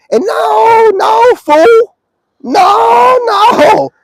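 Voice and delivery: shouting no. Real, not acted. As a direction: yell them off, cutting the fool down. Shouting down a critic.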